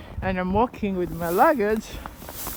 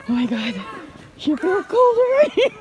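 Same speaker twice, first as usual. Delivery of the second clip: shocked by cold; 8 semitones higher, 6 dB louder than usual. Real, not acted. Shivering in heavy snow.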